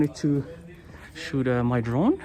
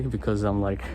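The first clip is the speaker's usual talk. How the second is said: usual speaking voice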